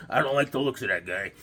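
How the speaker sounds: low, soft Sopranos-style mobster; lightly voiced wary gangster; mobster mutter. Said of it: "They're like".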